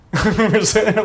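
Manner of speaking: high, stifled laugh; burst of laughter. Real, not acted. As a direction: crack up at the story. Laughing at the absurd report.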